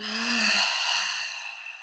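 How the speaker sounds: long soft exhaling sigh; audible sigh. Shown as a demonstration. Teacher showing the class how to sigh out, soft sigh. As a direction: let the breath fall out in a sigh. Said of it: "sigh it out".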